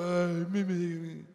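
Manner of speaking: loud, exaggerated moaning of pain; mock whimpering cry. Lecturer mimics a crying man with kidney pain, hammed-up moans. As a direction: whine like a grown man in agony.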